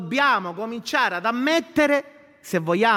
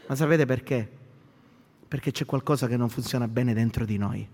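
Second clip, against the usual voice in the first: hushed prayer; 7 semitones lower than usual. Acted. Pastor leading the church into prayer, hushed and low.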